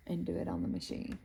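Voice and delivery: ordinary delivery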